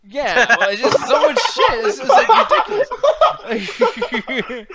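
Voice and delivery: loud, high, real laughter through words; helpless, loud laughing delivery; laughing hard. Real, not acted. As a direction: crack up while trying to explain. Breaks up laughing while answering.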